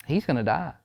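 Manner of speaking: soft, grave doctor's verdict; mock sad. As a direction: deliver grim news quietly, lightly acted. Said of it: "very sad and goes".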